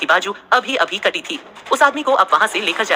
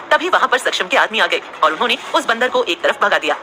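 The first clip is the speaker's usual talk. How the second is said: ordinary delivery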